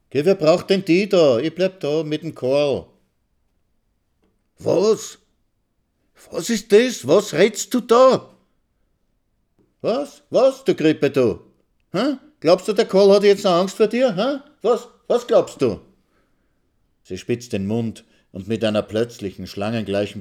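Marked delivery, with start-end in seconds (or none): 0.1-2.9 high, loud scornful jeer; mocking wife, mouth twisted in scorn; contemptuous taunt. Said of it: "verächtliches Gesicht". 4.6-8.3 high, loud incredulous cry; can't believe what he hears; stunned husband, voice climbing; shocked disbelief. Acted. Husband shocked by wife's rejection. 9.8-15.8 high, loud mocking jabs; wife sneering at her husband, high and loud; shrill taunting.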